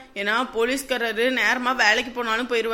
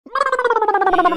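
wail; 9 semitones higher, 7 dB louder, much slower than usual. Acted. Starving character laments finding no food.

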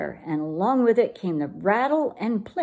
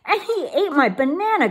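higher, louder complaint; complaining; 8 semitones higher, 4 dB louder than usual. Acted. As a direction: grumble to Mama indignantly. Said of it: "complained Jezebel".

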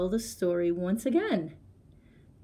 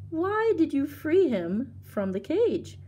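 puzzled question; 8 semitones higher, slower than usual. Acted.

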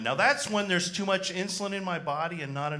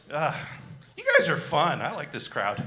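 usual speaking voice

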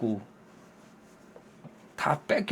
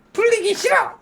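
put-on high childish refusal; sulky kid brushing dad off. Daughter refusing to play with dad, high childish sulk.

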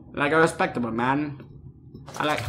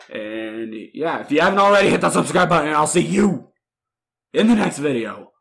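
upbeat outro; 4 semitones higher, 7 dB louder than usual. Acted. Energetic YouTube outro.

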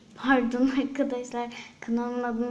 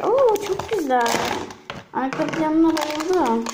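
excited delight; 3 semitones higher, 5 dB louder, slower than usual. Real, not acted. Delighted at unboxing background.

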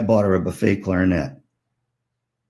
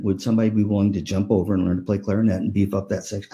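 ordinary talk

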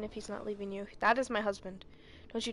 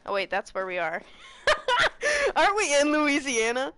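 loud, high laughing jeer; laughing taunt; 4 semitones higher, 11 dB louder than usual. Real, not acted.

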